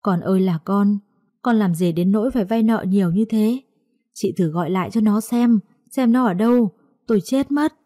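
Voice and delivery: plain reading voice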